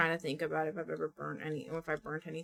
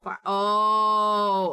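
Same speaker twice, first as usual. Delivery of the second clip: a sudden loud cry cut short; jolted by something on screen; startled yelp; 3 semitones higher, 10 dB louder than usual. Real, not acted. Streamer startled by the game, loud yelp.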